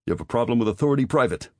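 fast, loud, high grilling; interrogating. A narrator's reading. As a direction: fire the question fast. Major interrogating the private.

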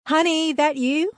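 high, loud questioning call. A narrator's reading. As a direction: call to your kid from the kitchen. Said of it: "Mum called from the kitchen".

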